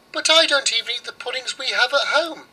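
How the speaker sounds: put-on high, proud little girl; girl's voice. Girl scorns the oven's rye pies, high and haughty. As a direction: turn the pie down with a toss of the head.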